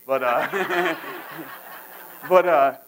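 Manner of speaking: laughing through the words; chuckling. Real, not acted. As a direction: chuckle at your own joke, then pick up again. Laughs at own daughter joke.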